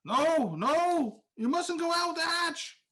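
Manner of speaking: high, loud urgent shout; shouting after her. Acted. Recovered character warning the one fleeing, shouting. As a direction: shout a desperate warning up the stairs. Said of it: "shouting after her".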